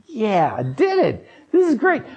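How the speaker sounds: high, swooping self-congratulation; proud, gleeful pat on the back; pleased with himself. Retold or imitated. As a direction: crow over your own little triumph. Self-congratulation in retold story.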